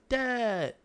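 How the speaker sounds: put-on high, bright little kid calling Dad; kid greeting dad. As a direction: chirp it like a small son at the door. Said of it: "Xander's like".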